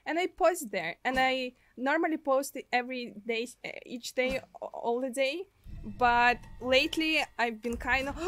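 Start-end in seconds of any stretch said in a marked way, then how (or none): none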